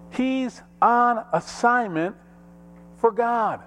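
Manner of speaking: high, slow preacher declaration; ringing sermon declaration; emphatic proclamation. Real, not acted. Preacher proclaims his main point.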